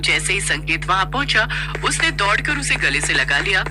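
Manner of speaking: usual speaking voice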